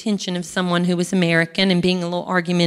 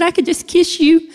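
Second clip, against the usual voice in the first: high, gushing relief through mild tears; lightly tearful, overjoyed squeal; retold tearful gush; 10 semitones higher than usual. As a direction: burst out with grateful, teary joy. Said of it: "And I was just bawling".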